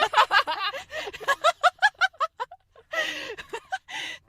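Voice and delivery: high, loud burst of laughter; helpless high-pitched cackling fit; shrieking laughter. Real, not acted. Friend suddenly remembers funny story.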